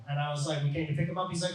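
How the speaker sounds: normal talking voice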